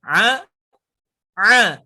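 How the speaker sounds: loud ain comparison; ain contrast. Shown as a demonstration. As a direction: say A both ways for comparison. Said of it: "seperti kieu".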